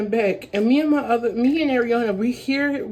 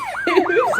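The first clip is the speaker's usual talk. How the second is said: sudden high burst of laughter; a quick panicked giggle; nervous laugh; 5 dB louder than usual. Real, not acted. Nervous laugh mid-retelling.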